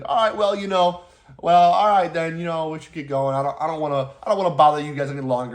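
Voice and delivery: put-on Midwest accent. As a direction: play up a folksy Midwest accent. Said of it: "I'm doing the Midwest accent".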